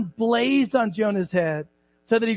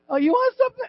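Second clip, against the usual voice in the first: high mocking mom voice, stress on want; a mom's sharp threat, pitched up; 14 semitones higher, much faster than usual. A preacher imitating his mom: high mocking threat. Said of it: "she'd go".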